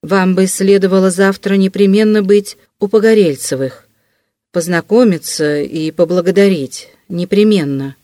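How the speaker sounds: usual speaking voice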